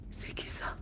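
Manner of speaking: hushed, breathless question; frightened whisper. Acted. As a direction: whisper it as if afraid of being heard. Woman whispers in fear. (chuchotement apeuré)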